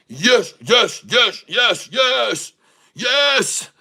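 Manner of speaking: repeated high yelps of joy; ecstatic cheering. Real, not acted. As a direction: chant it in rising excitement. Celebrating the rising chart.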